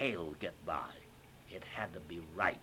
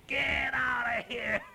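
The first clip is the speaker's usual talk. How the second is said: loud, high, slow laughing shout; mock shout; 8 dB louder, slower than usual. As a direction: shoo him off with a big yell. Producers shooing him, laughing.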